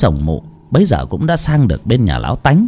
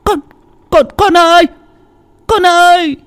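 put-on high desperate yell to her child; desperate high shout calling her son. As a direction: scream out for the child. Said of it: "đánh bạo mà hét lên".

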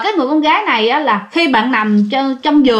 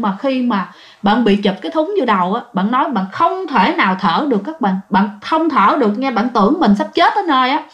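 usual speaking voice

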